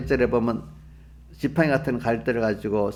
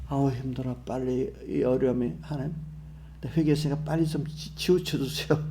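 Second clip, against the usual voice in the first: softer, put-on complaining prayer; mock weary pleading; 6 dB quieter than usual. Preacher imitates a believer begging God to remove hardship, mock weary plea. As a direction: plead to be let off, half under strain. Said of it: "이렇게 하는 사람하고".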